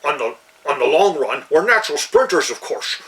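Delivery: imitating a hammy movie-dwarf Scottish brogue; mock Scottish accent. Actor told to play the dwarf, in mock Scottish accent. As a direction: roll it out in a put-on Scottish dwarf voice. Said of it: "they gave him, you know".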